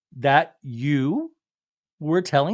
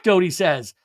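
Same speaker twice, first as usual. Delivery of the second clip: usual speaking voice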